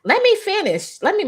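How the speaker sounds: high, odd assistant voice; funny assistant voice. Retold or imitated. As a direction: mimic her funny voice. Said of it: "she talked real funny".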